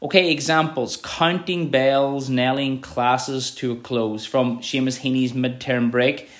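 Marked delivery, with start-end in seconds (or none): 1.0-4.3 very slow, stretching the vowels; slow, lingering poetry reading; slow drawn-out reading. Shown as a demonstration. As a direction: draw out each long vowel. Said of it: "The O's there really, um, create that long sentence".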